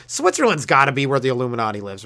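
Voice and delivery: ordinary talk, nothing marked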